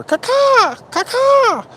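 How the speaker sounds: high falsetto caw-caw; crow caw. Retold or imitated. Cawing at the crazy neighbor, high falsetto. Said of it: "I go".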